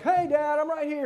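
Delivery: put-on high child's voice tugging at dad; lightly voiced small girl, insistent; little girl. Girl going up to her dad to be noticed, high childish plea. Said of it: "she's like".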